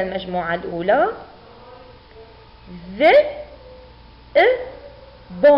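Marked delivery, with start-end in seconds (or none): 3.0-5.5 each sound short and alone, long pauses between. Shown as a demonstration. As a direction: sound out dhi - hamza - bun separately. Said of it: "سوف نجمعها".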